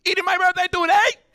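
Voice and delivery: high, loud Puerto Rican yell; Puerto Rican accent. Retold or imitated. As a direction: burst out as the Puerto Rican kid. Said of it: "And I'd be like".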